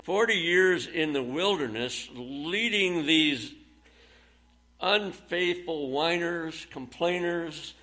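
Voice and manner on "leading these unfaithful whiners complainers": slow emphasis. Real, not acted. Emphatic sermon point.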